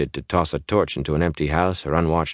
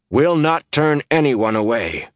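slow, raised, tightly controlled voice; firm and tense refusal; 10 semitones higher, 4 dB louder than usual. A narrator's reading. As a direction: hold the anger in tightly. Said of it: "he said in a tight voice".

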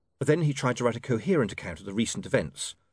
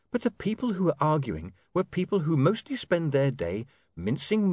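put-on higher, brisk young woman's voice; 6 semitones higher than usual. A narrator's reading. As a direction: voice her lightly as a young woman. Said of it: "Sacharissa lecturing William".

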